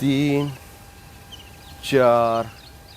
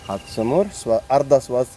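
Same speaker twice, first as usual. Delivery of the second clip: usual speaking voice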